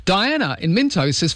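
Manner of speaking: ordinary talk, nothing marked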